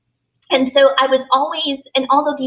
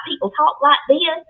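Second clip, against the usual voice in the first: exaggerated Southern accent; 5 semitones higher than usual. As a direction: imitate how Southerners sounded to a newcomer's ear. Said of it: "a very exaggerated Southern accent".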